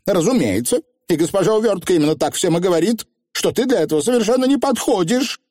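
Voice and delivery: high, hurried, self-important witch; smug rival witch; haughty young witch. Acted. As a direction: pitch it way up and put the rival down. Voices Anagramma, smug.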